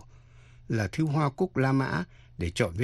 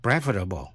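"Preferable" stressed on PREF; teacher's model of "Preferable", weight up front; first-syllable stress; 4 semitones lower than usual. Shown as a demonstration. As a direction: punch the opening of "Preferable" and let it fall. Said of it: "nhấn mạnh vào phần đầu".

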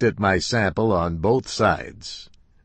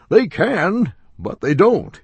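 ordinary delivery, nothing marked

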